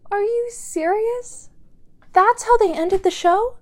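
can't believe that was the ending; incredulous. Acted. Disbelief at a show's ending.

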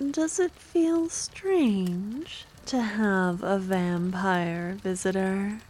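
ordinary talk

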